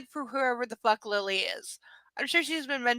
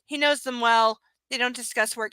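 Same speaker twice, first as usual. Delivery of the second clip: normal talking voice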